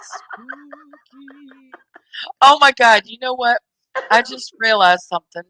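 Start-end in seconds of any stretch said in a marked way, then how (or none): 0.0-1.7 breathy ghostly whisper; spooky whisper. Acted. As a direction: whisper it eerily.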